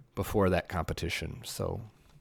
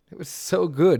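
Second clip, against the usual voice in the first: louder and higher, punched; emphatic praise; 9 semitones higher, 10 dB louder than usual. Real, not acted. Praising Mineral's park again, louder. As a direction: hit it hard, much louder.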